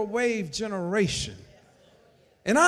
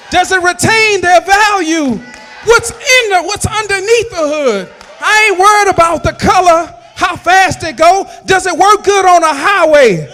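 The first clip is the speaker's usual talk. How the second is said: higher and louder, driving each question home; full-force preaching; 6 semitones higher, 9 dB louder than usual. Acted. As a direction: preach it hard to the congregation, rising in pitch.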